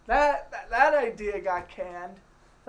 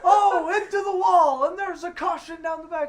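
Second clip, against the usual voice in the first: loud laughing play-by-play call; race commentator; 7 semitones higher, 7 dB louder than usual. Pretend. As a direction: call the crash like a race announcer. Mock race broadcast call.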